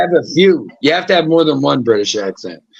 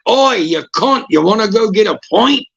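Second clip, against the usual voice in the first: higher, cheeky British lad; Street's British accent; 6 semitones higher than usual. Acted. Imitating Street inviting his friend out, cheeky British accent. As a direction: hail a mate in broad British. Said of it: "He's like".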